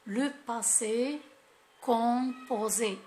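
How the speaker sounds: key term drawn out syllable by syllable; slow, emphatic naming. Shown as a demonstration. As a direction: spell out the term slowly for the class. Teacher stresses the tense's name. (نطق بطيء ومشدد مقطعًا مقطعًا)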